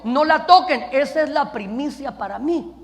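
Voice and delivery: loud, emphatic prohibition; forceful command. Acted. Preacher voicing God's warning about the city, forceful command. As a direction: thunder it out as a divine order.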